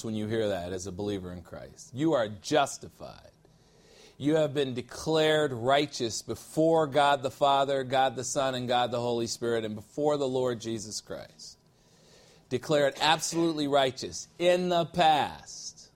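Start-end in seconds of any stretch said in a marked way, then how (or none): none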